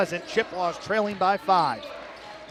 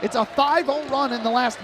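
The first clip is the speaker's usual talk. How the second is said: excited call; 5 semitones higher, 3 dB louder than usual. Real, not acted. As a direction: let the comeback swell in your voice. Comeback closes the gap.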